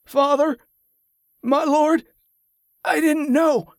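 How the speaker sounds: high, pleading feverish voice; delirious cry. A narrator's reading. Delirious man crying out to the Father, high and pleading.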